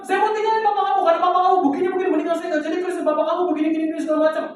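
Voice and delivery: high, worked-up voice, rattling off complaints; upset mother on the phone. Retold or imitated. Mother phones her child to complain about father, high and worked-up. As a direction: imitate a mother venting in a high-pitched voice. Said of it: "Terus akhirnya dia telepon".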